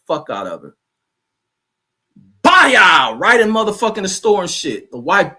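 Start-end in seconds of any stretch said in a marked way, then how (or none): 2.4-3.2 shouted slap sound. Retold or imitated. Slap sound effect in retold story.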